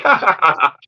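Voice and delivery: mock villain laugh, ha ha ha. Roman Reigns laughs at injured Cody, mocking laugh. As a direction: gloat with a put-on laugh. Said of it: "and he's like".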